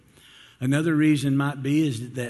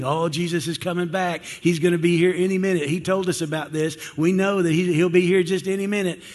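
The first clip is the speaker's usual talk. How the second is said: mock bold proclamation, raised pitch; bold preaching; 4 semitones higher, 5 dB louder than usual. Imagined disciples announce Jesus is coming back, preaching boldly. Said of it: "they're preaching really boldly".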